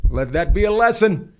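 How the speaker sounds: high, loud proclamation from a balcony; mock yell. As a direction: bellow it down at the whole block. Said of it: "I yelled off the balcony".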